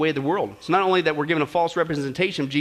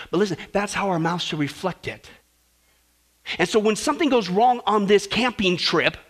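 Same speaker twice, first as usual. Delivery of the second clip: usual speaking voice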